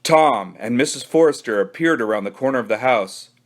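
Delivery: ordinary delivery, nothing marked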